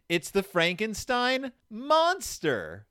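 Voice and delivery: high, slow theatrical alarm; cried out. A narrator's reading. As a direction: build dramatically to the monster. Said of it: "cried Sister".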